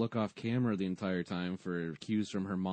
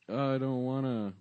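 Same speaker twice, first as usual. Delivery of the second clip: mock reluctance; 5 semitones higher, 3 dB louder than usual. Acts reluctance to feed frog.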